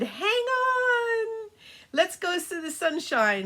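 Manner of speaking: high, bright call to hold on; rally the kids for take-off; cheerful Pegasus. Acted. Pegasus telling the children to hold on, high cheerful call.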